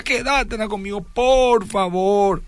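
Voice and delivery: pleading. Retold or imitated. Retold pleading to girlfriend.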